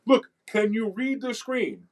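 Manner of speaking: high, slow, insistent questioning; exasperated appeal. Acted. Teacher to skeptics refusing to read the screen, exasperated. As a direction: spell it out slowly for someone who won't listen.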